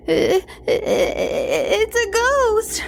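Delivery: put-on girl stammering at a ghost; scared. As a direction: stutter it out, terrified. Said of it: "said Jessie".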